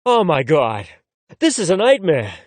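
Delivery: ordinary delivery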